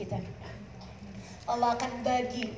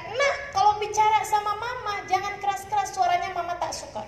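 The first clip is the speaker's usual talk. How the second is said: lightly voiced mother admonishing her child, higher; scolding mother; 9 semitones higher than usual. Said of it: "bilang sama mereka". (ibu menegur anak, nada tinggi)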